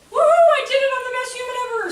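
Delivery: high, loud woohoo of victory; mock triumphant cheer. A teacher hamming up reaching self-actualization, with a loud, high cheer. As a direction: crow it like you just won everything.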